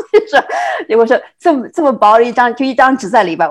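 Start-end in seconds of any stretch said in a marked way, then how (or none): 0.1-0.4 high laugh. Real, not acted. Laughs recalling phone call.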